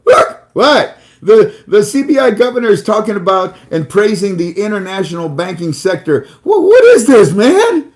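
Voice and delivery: high squealing excited rant; all excited. Retold or imitated. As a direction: play the friend babbling in wild excitement. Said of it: "he's all excited".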